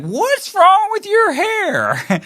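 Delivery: high, slow mock mom voice; mom's nagging voice. Mimicking his mom asking about his hair, high and slow. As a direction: pitch way up and drag it out like a fussing mom. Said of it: "I would hear that all the time from my mom".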